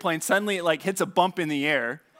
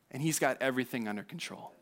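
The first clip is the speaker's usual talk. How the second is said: much softer, hushed and lower; soft assurance; 5 semitones lower, 9 dB quieter, faster than usual. Real, not acted. Preacher assuring the congregation, hushed and soft. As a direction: drop it to a near hush. Sermon point, repeated softly.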